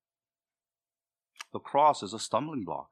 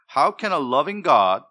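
louder, higher mock protest; indignant objector; 5 semitones higher, 9 dB louder than usual. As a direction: challenge loudly as the imagined objector. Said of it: "you've all heard this reasoning".

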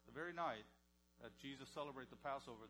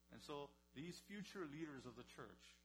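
ordinary talk, nothing marked

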